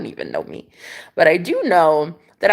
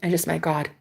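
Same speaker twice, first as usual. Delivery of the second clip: hushed; 4 semitones lower, 10 dB quieter than usual. Retold or imitated. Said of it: "I'm like".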